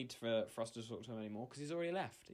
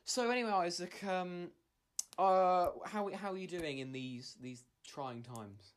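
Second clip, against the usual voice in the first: mock interviewer; 5 semitones higher, 4 dB louder, slower than usual.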